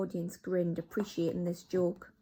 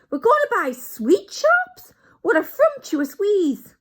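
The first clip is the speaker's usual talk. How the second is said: much higher, loud, slow delighted squeal; astonished witches; 14 semitones higher, 13 dB louder, slower than usual. Acted. Witches delight in the plan, high slow squeal. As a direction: marvel at the idea.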